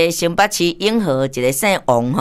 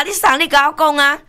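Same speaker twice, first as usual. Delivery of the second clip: acted phone exchange; 10 semitones higher than usual. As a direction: replay the phone call, pitched way up. (高声演出电话对话)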